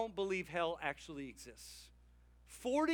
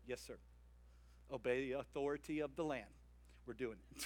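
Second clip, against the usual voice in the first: meek yes sir; 8 semitones lower, 12 dB quieter, faster than usual. Retold or imitated. Pastors answering staff meekly.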